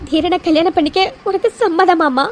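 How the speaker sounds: ordinary talk, nothing marked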